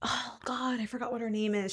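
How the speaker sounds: whispered "oh God" to herself. Real, not acted. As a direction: breathe it out under your breath. Forgets actress name.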